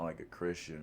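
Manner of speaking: ordinary delivery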